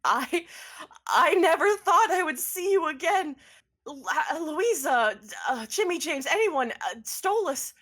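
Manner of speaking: put-on sobbing with relief at seeing an old friend; crying reunion. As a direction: choke it out through happy tears. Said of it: "She's crying and she says".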